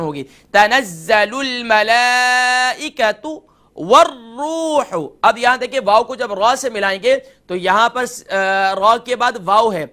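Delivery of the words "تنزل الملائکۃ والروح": تنزل الملائکۃ with a short hamza and short ta; slow melodic chant, vowels kept short; correct recitation. Shown as a demonstration. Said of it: "short sound ہوگا". (درست تلاوت، ہمزہ short)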